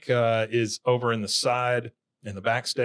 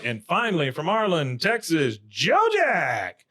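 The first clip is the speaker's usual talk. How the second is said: higher mock introduction rising to the name; imitated show announcer; 5 semitones higher, slower than usual. Dale introducing Joe Jack last, imitated announcer. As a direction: present the last act with fanfare. Acts out final stage introduction.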